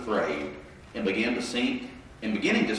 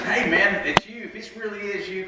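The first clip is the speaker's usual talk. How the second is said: voicing Peter; 9 semitones higher than usual. Peter voiced in retelling.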